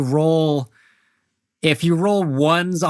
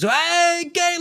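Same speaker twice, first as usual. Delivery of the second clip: very high, bright call of a friendly cabbie; cheery driver greeting; slower than usual. Acted. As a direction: sing out a hello to an old buddy. Driver Jerome greets Galen.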